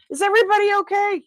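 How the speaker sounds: loud, very high, rushed question; hammed-up loud, squeaky concern; mock alarm. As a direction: call it out fast in high-pitched mock panic. Repeats check on dropped items, escalating.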